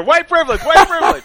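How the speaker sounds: mock his whining cry; mocking whine.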